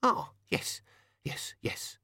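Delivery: high, then breathy fading yeses; nervous little toymaker; flustered shopkeeper. Acted. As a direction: shopkeeper caught off guard.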